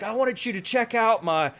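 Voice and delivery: high, put-on eager voice; excited fanboy. Evicted man showing officer his setup, excited imitation. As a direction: show it off like a gushing admirer.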